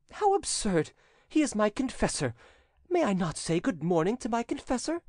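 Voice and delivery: put-on high-pitched protesting girl; indignant young damsel. A narrator's reading. Voices Gelsomina protesting to cousin.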